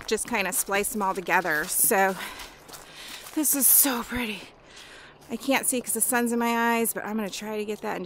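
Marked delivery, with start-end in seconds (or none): none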